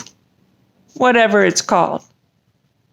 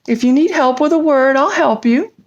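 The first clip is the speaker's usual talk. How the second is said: ordinary talk, nothing marked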